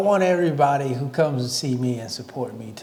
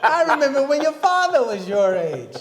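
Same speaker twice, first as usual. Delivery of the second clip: put-on old fan; 9 semitones higher than usual. Old fan to young riders.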